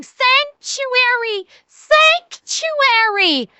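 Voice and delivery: high, slow, drawn-out wail of sanctuary; mocking cry of sanctuary to the hospital; mock Hunchback cry. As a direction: ham up the hunchback begging sanctuary. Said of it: "start suddenly going".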